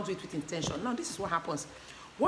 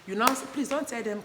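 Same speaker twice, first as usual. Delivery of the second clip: normal talking voice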